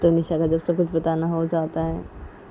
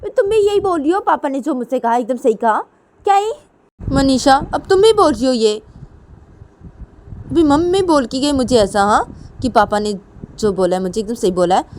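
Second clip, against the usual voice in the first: high, loud, indignant daughter voice; angry protest; 8 semitones higher, 6 dB louder than usual. Acted. Nisha to Manisha, who sides with Papa, angry protest. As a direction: snap back as if everyone sides against you. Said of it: "क्या इतना गुस्सा कर रही हो तुम".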